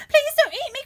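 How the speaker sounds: high, loud pleading; begging for his life; Jack crying out. A narrator's reading. Jack in the giant's fist, high frightened plea. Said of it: "cried Jack".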